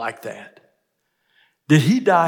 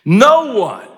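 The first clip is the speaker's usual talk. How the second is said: loud, high stress on "no one"; emphatic; 9 semitones higher, 10 dB louder than usual. Retold or imitated. Preacher repeating Jesus's words for force, emphatic. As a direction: hit "no one" hard and high, drawn out. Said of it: "that's pretty emphatic".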